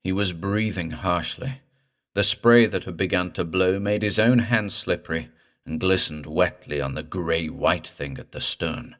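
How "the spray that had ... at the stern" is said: ordinary delivery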